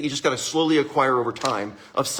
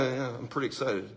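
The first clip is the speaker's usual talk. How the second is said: much softer, lower, deadpan claim of excitement; flat, unexcited; 4 semitones lower, 11 dB quieter than usual. Shown as a demonstration. Showing the audience how not to sound excited, dull and quiet. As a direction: say you're excited with zero energy, barely audible. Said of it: "don't go".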